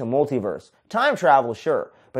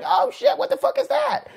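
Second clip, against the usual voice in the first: put-on squeaky, much higher kid voice; freaked-out kids. Kids shriek at creepy gifts in a squeaky high voice. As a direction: squeal it like a scared little kid.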